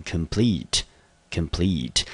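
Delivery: complete said slowly twice, stress on plete, kʰəm-PLIT; teacher drills complete for the class, slow and clear; slow model pronunciation. Shown as a demonstration. 老师慢速示范complete，重音在第二音节. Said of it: "我们就念成complete".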